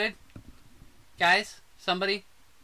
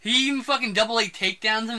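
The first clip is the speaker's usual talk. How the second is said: ordinary talk, nothing marked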